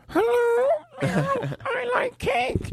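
squeaky high cat voice, slow; cat trying to talk. Retold or imitated. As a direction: mew the words out like a cat. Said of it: "Like, if you went".

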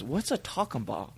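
soft, puzzled deadpan question; playing dumb. [name] confusing the angry driver, playing dumb. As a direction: ask quietly, as if it were one word. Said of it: "confused when [name] goes".

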